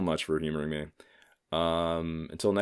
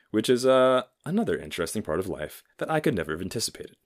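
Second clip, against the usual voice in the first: ordinary delivery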